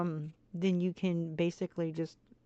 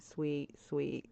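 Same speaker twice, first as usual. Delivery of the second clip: ordinary delivery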